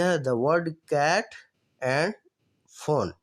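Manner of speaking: very slow, each word spaced out; slow dictation. Shown as a demonstration. Teacher reading the example aloud to students, very slow. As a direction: spell it out slowly for the class. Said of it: "اب دیکھیں یہاں پہ".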